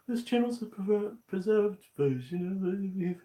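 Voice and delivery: high, loud mock-official reading. Streamer reading the channel's about text in a mock voice. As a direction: read the text out in a pompous voice.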